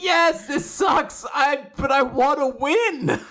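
high, drawn-out mock whine; exaggerated admission. Scumbag golfer admitting he wants to win, mock whining. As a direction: whine the confession slowly. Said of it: "And then he admits it".

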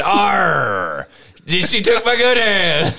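high, slow hammy pirate growl. Pretend. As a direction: play a cartoon pirate.

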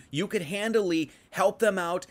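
ordinary talk

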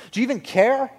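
high, loud acted question; Peter's accusing plea, acted out; pleading challenge. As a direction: demand it, voice leaping up. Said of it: "Peter goes from asking Jesus".

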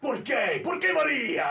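loud, high overwrought Spanish cry; telenovela drama. Retold or imitated. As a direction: ham it up like a soap opera lover. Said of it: "It's like".

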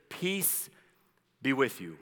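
soft blessing with a pause; gentle reassurance. Retold or imitated. Preacher quoting Jesus to the disciples, softly calming. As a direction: say it quietly, as a calming greeting.

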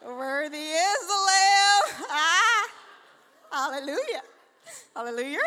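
loud, crying worship shout; mock worship. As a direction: ham up a weeping worshipper. Acting out worship while flirting.

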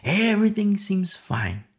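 ordinary talk, nothing marked

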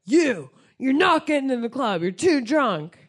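lightly voiced stern bouncer; mock bouncer turning someone away, stressed YOU. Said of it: "the fucking bouncer of the club comes out".

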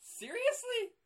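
loud, very high squeal of disbelief; astonished high-pitched outburst; incredulous. Real, not acted. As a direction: blurt it in amazed disbelief. Disbelief at not knowing Brokaw.

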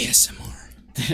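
slow, hushed whisper; mock ASMR whisper. Mimics ASMR when it is mentioned.

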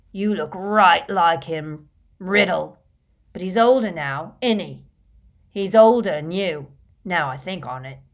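put-on loud, drunken rustic lout; rough rustic Morfin. A narrator's reading. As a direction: think it out loudly, suspiciously.

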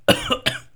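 sharp sputtering cough; choking cough. Real, not acted. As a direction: cough out water gone down wrong. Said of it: "I was choking on water".

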